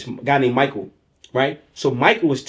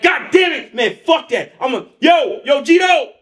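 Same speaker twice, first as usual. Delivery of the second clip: shouting; 10 semitones higher than usual. Retold or imitated. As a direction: yell out for your man. Aliko shouting for his friend.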